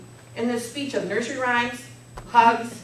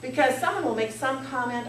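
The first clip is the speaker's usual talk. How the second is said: usual speaking voice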